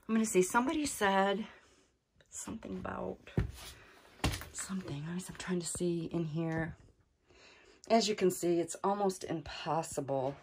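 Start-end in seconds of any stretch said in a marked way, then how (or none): 2.3-6.8 whisper. Real, not acted. Peering into dark corner.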